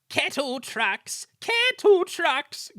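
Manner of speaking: slow, high, rising indignation; shrieky drawn-out grumble; grumbling coaches. A narrator's reading. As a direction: squawk each word out crossly. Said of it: "grumbled the coaches".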